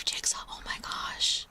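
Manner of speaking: ordinary talk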